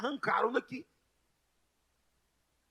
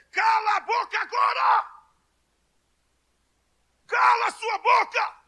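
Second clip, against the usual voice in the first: shouted much higher, a furious rebuke; 12 semitones higher, 4 dB louder than usual. Retold or imitated. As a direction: yell it at him to shut up. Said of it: "eu esbravejei". (grito furioso e bem agudo)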